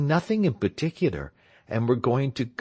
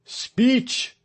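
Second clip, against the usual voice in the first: high calling cry; 14 semitones higher than usual. A narrator's reading. Someone at the crowd's edge calling for a speech, high cry. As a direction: call it from the back of the crowd. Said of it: "cried someone from the edge".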